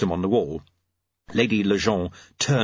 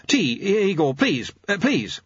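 high, stammering hesitation; high-voiced, rattled clockmaker; flustered; 9 semitones higher than usual. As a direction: dither nervously before agreeing. Jeremy flustered, asks for tea.

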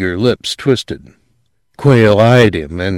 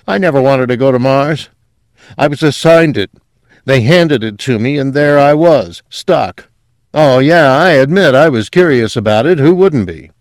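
high, loud character voice, defensive; sedated man insisting, pitched up and louder; drugged, defensive Quayle; 7 semitones higher, 6 dB louder than usual. Acted. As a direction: protest in a raised, put-on voice.

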